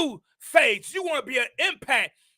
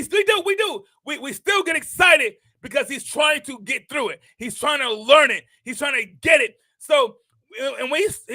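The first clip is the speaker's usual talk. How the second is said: usual speaking voice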